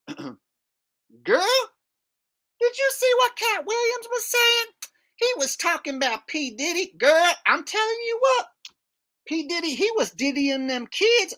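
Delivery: high-pitched caricature of a woman; excited, high gossiping falsetto. Acted.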